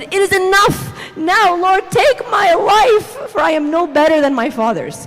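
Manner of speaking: mock-despairing dramatic outcry; hammed-up drama. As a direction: read it as a melodramatic cry to God. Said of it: "Okay, the drama, the drama".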